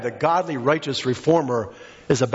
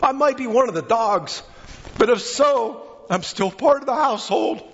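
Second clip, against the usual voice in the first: high, pleading preacher voice on the woman's words; impassioned sermon plea; 7 semitones higher than usual. Acted. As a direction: plead her case from the pulpit.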